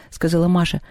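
ordinary delivery

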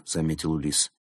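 ordinary delivery, nothing marked

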